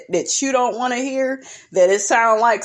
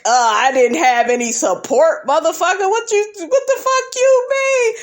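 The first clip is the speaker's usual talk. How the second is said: imitating Jalen fed up; 9 semitones higher than usual. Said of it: "Jalen is looking at him like".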